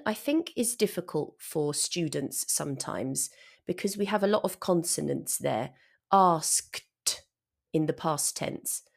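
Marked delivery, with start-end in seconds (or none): 6.1-6.8 asked with every sound, s k t; careful asked. Shown as a demonstration. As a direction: say Asked clearly with the k. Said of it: "We've got sk-t".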